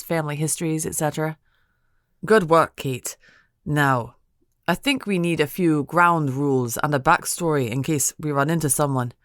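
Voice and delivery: plain reading voice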